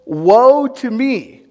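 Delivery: slow, loud, drawn-out cry of despair; anguished lament. Acted. Preacher voicing Isaiah's terror before God, slow anguished cry. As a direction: stretch out each word in dread. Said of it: "He says".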